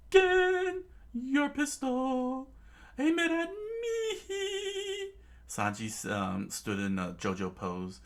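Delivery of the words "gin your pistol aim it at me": very high, loud, very slow sing-song; high drawn-out dare. Acted. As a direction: dare him to shoot. Sanji dares Gin.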